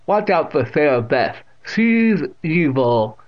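put-on loud, drawn-out old woman; deaf old aunt warning. A narrator's reading. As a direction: warn loudly, stretching the words.

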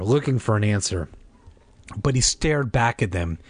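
usual speaking voice

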